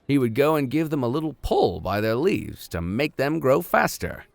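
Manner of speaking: measured storybook reading voice; story narration. A narrator's reading. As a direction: read it like a classic adventure novel. Reads novel aloud as narrator.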